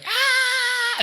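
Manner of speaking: very high imitated scream; mock scream. Journalist screaming as she is sliced, high mock scream. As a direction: scream like someone being attacked. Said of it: "she's going".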